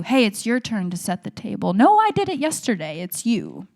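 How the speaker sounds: loud back-and-forth bickering, mildly acted; mock squabbling. Siblings arguing over whose turn to set the table, mock squabble. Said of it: "when we'd argue on cue".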